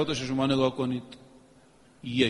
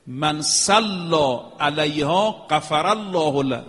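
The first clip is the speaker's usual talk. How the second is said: slow Arabic recitation; much slower than usual. Real, not acted. Reciting Arabic hadith in sermon.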